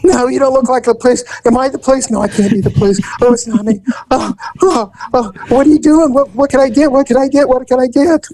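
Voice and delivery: high, jittery mock panic; nervous Nelly. Nervous customer at the car window, high jittery mock panic. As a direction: babble like a terrified first-timer. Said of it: "here's the nervous Nelly".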